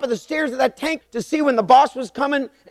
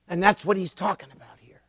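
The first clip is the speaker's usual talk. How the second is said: whisper; 5 semitones lower, 7 dB quieter than usual. Real, not acted. Unvoiced aside after story.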